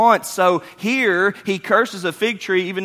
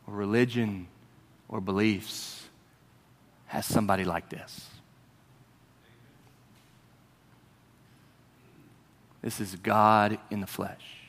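low, soft, with long pauses; hushed, solemn preaching; 7 semitones lower, 8 dB quieter than usual. Real, not acted. Preacher declaring who Jesus is, hushed and reverent.

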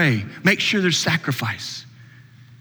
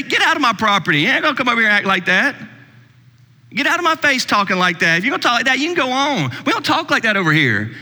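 higher-pitched, hammed-up scolding; mock angry blasting; 7 semitones higher, faster than usual. Preacher acting out what God didn't say, mock angry blast. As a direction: imitate someone throwing people off their property. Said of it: "He didn't just blast them".

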